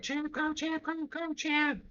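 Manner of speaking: high mock sideline cheer; imitating cheering parents. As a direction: cheer him on like a lacrosse parent. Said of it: "the parents are like".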